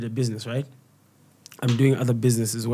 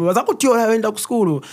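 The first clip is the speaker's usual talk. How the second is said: high, louder mock scolding parent; 9 semitones higher, 5 dB louder, faster than usual. Mocking a scornful parent. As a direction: jeer like a scornful parent at the kid. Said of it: "It's like".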